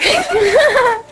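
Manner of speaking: high teary shriek of laughter. Real, not acted. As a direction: burst into a helpless high laugh. Laughing fit, can't stop.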